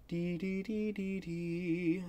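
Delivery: high, soft sung trill; trill imitation. Shown as a demonstration. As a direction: sing the square-wave jumps. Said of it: "a square wave looks like this".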